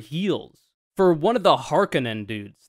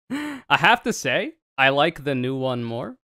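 ordinary delivery

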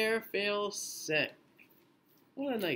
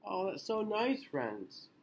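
usual speaking voice

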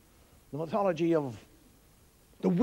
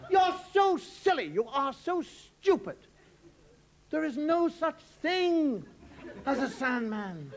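exasperated mother; 8 semitones higher, slower than usual. Retold or imitated. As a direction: drag out each word at the silly child. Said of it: "And she goes".

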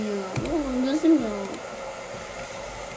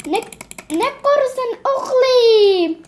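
higher, louder, slow mock mother; mother shocked at her daughter, hammed-up; shocked mum; 9 semitones higher, 5 dB louder, slower than usual. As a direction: drag out the mum's disbelief. Mother voice again.

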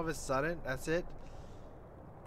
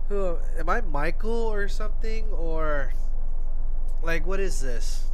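loud confused outburst; 2 semitones higher, 9 dB louder than usual. Real, not acted.

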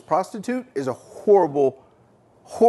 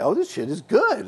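higher, excited delight; 8 semitones higher than usual. Retold or imitated. Boy at new home with food and clothes, delighted. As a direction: savor the surprise of having food. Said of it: "I'm like".

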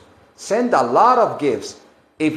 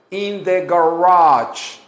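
usual speaking voice

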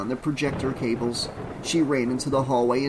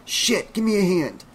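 higher, strained call for help; urgent exclamation; 6 semitones higher than usual. A narrator's reading. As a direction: exclaim it in sudden alarm. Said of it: "Rob exclaimed".

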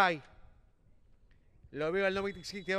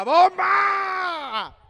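urgent shout; 7 semitones higher, 8 dB louder than usual. Real, not acted. Urging a shot.

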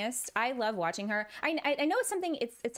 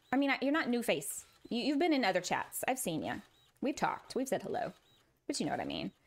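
normal talking voice

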